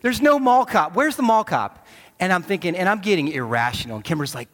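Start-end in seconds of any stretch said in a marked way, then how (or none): 0.0-1.8 panicked shout. Retold or imitated. Retold panic at the mall.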